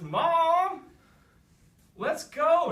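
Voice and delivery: put-on high, loud childish yell for mom; whiny shout. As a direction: yell for mom like a spoiled kid. Prince whining for his mom.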